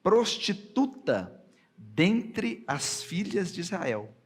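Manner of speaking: slow, emphatic verse reading, punched openings; scripture reading. Acted. Preacher reads God's order about Anat to the congregation, slow and emphatic. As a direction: proclaim the verse slowly and loudly, pausing between phrases.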